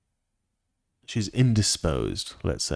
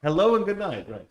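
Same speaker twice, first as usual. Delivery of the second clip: higher echo of his wife; imitating wife; 8 semitones higher than usual. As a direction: lightly mimic your wife, pitched up. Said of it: "She says".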